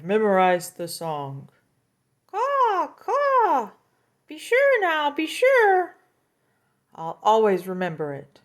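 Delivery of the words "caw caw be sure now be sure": very high, loud cawing; shrill mocking crow. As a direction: caw it out like a crow.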